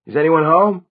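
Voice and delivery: high, loud questioning call. A narrator's reading. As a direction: call through the apartment for anyone there. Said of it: "he called".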